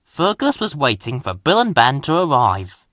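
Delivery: usual speaking voice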